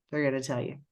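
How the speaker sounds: normal talking voice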